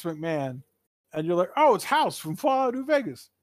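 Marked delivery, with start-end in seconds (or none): 1.6-3.3 high, bright-eyed exclamation, raised a lot; mock excited gamer. Mocking friends who see House from New Vegas, high. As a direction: imitate the gamer spotting House.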